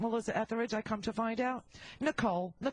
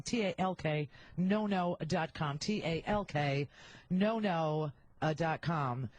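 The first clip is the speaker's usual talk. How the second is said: careful slow ad read, letter by letter; slow spelled-out address; 6 semitones lower, slower than usual. Shown as a demonstration. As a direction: spell each letter slowly. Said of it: "spells out web address".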